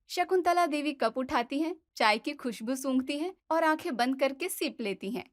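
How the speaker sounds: usual speaking voice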